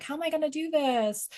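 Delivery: high, panicky retelling of her own worry; mock distressed wail; panicked quote. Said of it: "I'm like".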